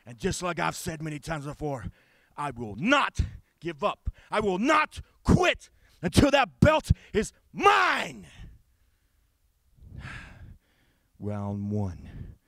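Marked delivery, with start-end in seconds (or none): 2.4-8.3 slow, high, loud shout; shouted vow. Acted. Wrestler vowing to win the belt. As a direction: bellow the vow.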